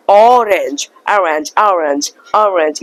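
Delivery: orange with the O opened toward A, ahh-range; American orange. Shown as a demonstration. Teacher demonstrating American orange, O like A. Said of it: "Try to speak the O sound like the A sound".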